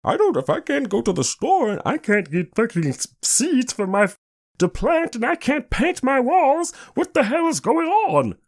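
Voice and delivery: high-pitched mocking whiner. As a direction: mimic clueless complainers in a squeaky mock voice. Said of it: "in my mocking voice".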